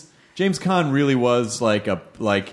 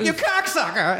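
aggressive swearing; 12 semitones higher than usual. Retold or imitated. Retelling how she swore at him, high mock aggression. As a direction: mimic her high, aggressive curse. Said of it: "she got a little aggressive with him".

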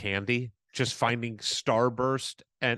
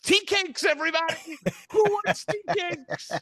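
mock chipper. Mocking his own entrance to the sad table, high mock chipper call. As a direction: sing it out, oblivious and cheerful.

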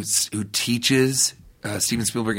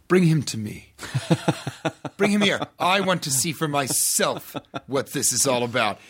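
higher, commanding mock Spielberg voice with a laugh; Spielberg impression; 4 semitones higher than usual. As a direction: play the famous director summoning him grandly. Spielberg voiced in retold story.

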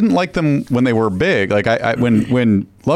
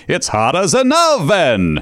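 [name] impression; 6 semitones higher, slower than usual. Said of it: "weatherman [name] to find out".

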